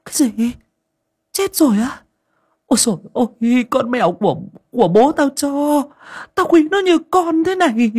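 put-on high, loud stunned old woman's cry; old mistress shocked her beloved cat has died; shocked dismay. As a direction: gasp out the bad news in disbelief. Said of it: "Bà Linh sửng sốt".

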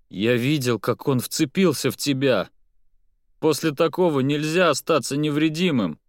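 through gritted teeth. Acted. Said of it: "процедил Петр".

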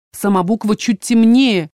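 ordinary delivery

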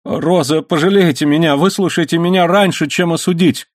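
high-pitched piteous begging; pleading, voice lifted high; plaintive pleading. A narrator's reading. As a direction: beg her to hear you out. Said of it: "жалобно воскликнул Лайонель".

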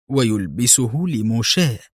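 ordinary delivery

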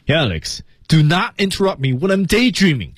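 high-pitched, displeased demo order; displeased command. Shown as a demonstration. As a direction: order Alex off, put out but crisp. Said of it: "不高兴啊". (不高兴的命令口气，干净利落)

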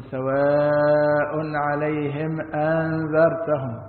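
very slow chant, أنذرتهم with the second hamza swallowed; dropped hamza. Shown as a demonstration. Teacher chanting the mistaken reading that drops a hamza. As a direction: intone أنذرتهم as a plain a- only. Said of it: "يأكل الهمزة الثانية".

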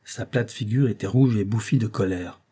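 ordinary delivery

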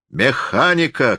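high, drawn-out exclamation; exclaiming. A narrator's reading. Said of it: "крикнул он". (восклицает высоко, врастяжку)

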